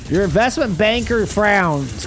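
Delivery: ordinary talk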